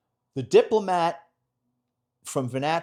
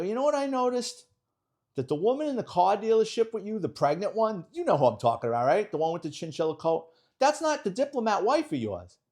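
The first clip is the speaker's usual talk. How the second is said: retold gotcha; 9 semitones higher, 4 dB louder than usual. Detective springs gotcha on diplomat.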